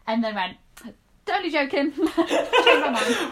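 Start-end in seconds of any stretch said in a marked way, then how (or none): 1.2-3.3 giggling through a mock retraction; laughing. Teasing a friend about the hair dye, laughing. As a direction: laugh the words out, loud and slow.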